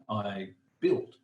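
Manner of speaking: ordinary talk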